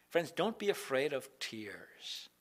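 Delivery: soft slow whisper; tender reassurance; whispered comfort. Acted.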